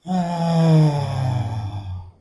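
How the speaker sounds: long sigh out on the exhale, falling away; audible sigh. Shown as a demonstration. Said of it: "we always do that audible sigh".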